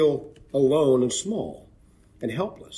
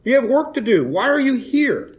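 God's challenge; 10 semitones higher, 4 dB louder than usual. Retold or imitated. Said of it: "he wanted Elijah to think about".

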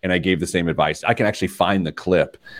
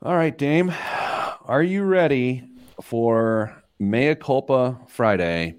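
higher, slow, drawn-out show announcement; segment announcer voice; 5 semitones higher, slower than usual. Acted. Host kicks off the Friday segment, grandly announcing it.

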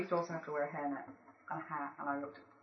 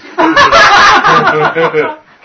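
loud laugh; 14 semitones higher, 28 dB louder than usual. Real, not acted. Big laugh at misreading.

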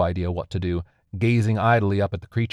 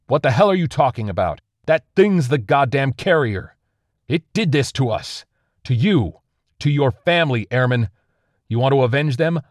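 high, loud angry tirade; an enraged officer threatening a soldier; furious berating; 6 semitones higher, 5 dB louder than usual. Acted. Officer berates airman in anger.

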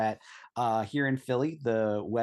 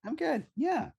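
squeaky cheer; slower than usual. Acted. Guest squeaks a cheery reply.

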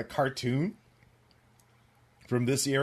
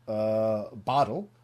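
normal talking voice

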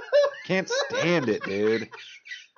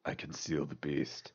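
low quiet delivery; 9 semitones lower, 12 dB quieter than usual. Shown as a demonstration. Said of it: "I don't release the beast".

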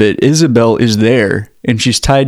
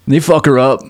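normal talking voice

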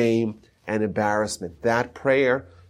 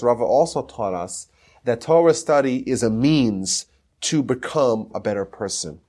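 normal talking voice

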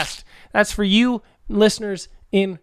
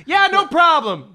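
Billy Mays voice; 11 semitones higher than usual. Acted. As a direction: shout it like an infomercial host.

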